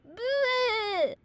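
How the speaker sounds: high retching bleh; over-the-top vomiting noise; big fake vomit. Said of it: "overload on barfing at the end".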